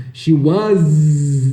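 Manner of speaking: SHE WAS with overdone buzzing "zzz"; exaggerated "shee wuhzzzz" drill; forced pronunciation. Shown as a demonstration. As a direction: hold the Z of WAS far too long. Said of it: "você não vai dar aquela forçada assim".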